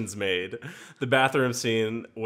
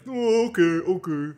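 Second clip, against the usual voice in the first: put-on high kid voice, oh okay okay; meme kid voice; 8 semitones higher, 5 dB louder than usual. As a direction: copy the meme kid's reaction. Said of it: "That's definitely the sound".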